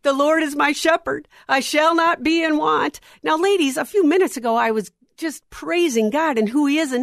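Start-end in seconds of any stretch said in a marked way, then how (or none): 0.0-3.0 a higher, louder urgent recited prayer; praying out loud. Retold or imitated. Running down the mountain in the storm, urgent loud prayer. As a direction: pray the psalm aloud in a hurry. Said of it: "I'm starting to pray out loud".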